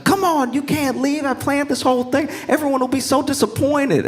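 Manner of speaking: high-pitched, whiny mock begging; pleading. Man begging his bride not to leave, acted pleading. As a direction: beg in a desperate squeaky voice. Suitor begs her to stay.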